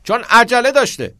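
high, loud pupil's shout; cheeky shouted answer. Acted.

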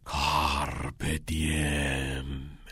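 low, soft, very drawn-out murmur; hushed, stretched-out murmur. Acted. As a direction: intone it slowly like a ghostly voice from the past. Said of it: "صدای زمزمهٔ کتینگ بلند شد".

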